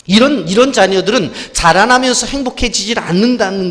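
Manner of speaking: full-force preaching. Acted. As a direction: drive the warning home to the church.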